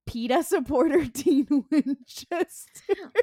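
laughing through the words, drawn out; cracking up. Real, not acted. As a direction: say it while losing it laughing. Cracking up at her own joke.

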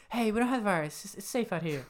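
half-hearted Wahlberg, pleading with strangers; mock Wahlberg pleading. Retelling Wahlberg pleading at the door, mock voice. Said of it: "he's like".

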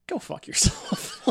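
drawn out through laughter; slow, laughing dismissal; laughing insult. Real, not acted. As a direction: let the laugh take over the words. Joking insult to the brands.

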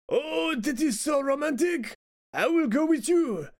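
comic French lover, pitched well up; French accent. Acted. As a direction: gush romantically in a hammed-up French accent. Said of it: "I'll do a French accent".

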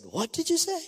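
a startled man who can't believe his ears; sudden high shocked reply; shocked disbelief. Retold or imitated. Man shocked by pastor's words.

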